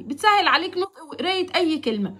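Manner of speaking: ordinary talk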